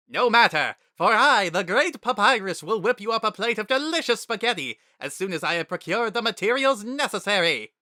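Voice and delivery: Papyrus, grandly boasting. A narrator's reading. Papyrus promising Frisk spaghetti, loud grand boast. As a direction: announce it like a grand hero.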